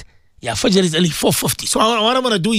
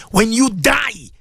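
ordinary talk

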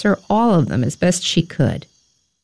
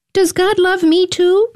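put-on high little boy voice; small son asking his mother, pitched high; child's question; 11 semitones higher than usual. A narrator's reading. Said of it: "asked Samuel one day".

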